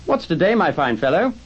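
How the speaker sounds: high, hearty call. A narrator's reading. Scrooge to the boy below, hearty high call. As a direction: hail the lad below with delight. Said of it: "calling downwards to a boy".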